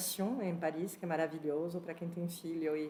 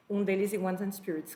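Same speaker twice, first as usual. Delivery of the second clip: ordinary delivery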